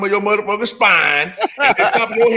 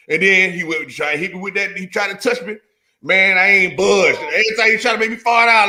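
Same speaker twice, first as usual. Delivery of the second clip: ordinary talk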